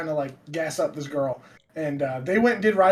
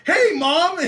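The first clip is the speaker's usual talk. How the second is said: high, loud, oblivious chirp; cheerful kid greeting; 13 semitones higher, 7 dB louder than usual. Retold or imitated. Boy greets his furious mother, bright and loud. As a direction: sing out happily, clueless.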